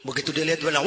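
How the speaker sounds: ordinary talk, nothing marked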